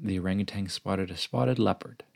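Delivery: ordinary delivery, nothing marked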